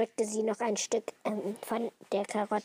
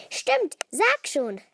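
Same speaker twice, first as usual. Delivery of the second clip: higher, slow urging call; 10 semitones higher, 4 dB louder, slower than usual. A narrator's reading. Anna and Billy pressing their friend, urging call. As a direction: call out to coax it out of her. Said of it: "riefen Anna und Billy".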